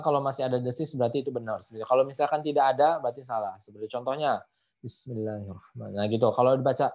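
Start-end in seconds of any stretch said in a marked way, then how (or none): 4.8-6.0 soft, low recitation checking for the hiss; suara pelan. Shown as a demonstration. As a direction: recite it quietly so only the hiss carries. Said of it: "pelankan suara kita". (suara dipelankan, desis tetap terdengar)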